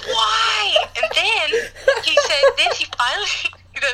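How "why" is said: high mock-furious cry; hammed-up outraged wail; enraged dad. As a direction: imitate a father too angry to say more. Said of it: "he was so mad".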